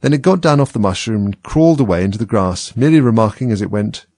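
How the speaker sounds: ordinary delivery, nothing marked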